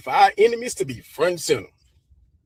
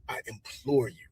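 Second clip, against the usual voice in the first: quiet earnest plea; 5 semitones lower, 9 dB quieter than usual. Real, not acted. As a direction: drop to a soft, earnest repeat. Repeats the plea, softly.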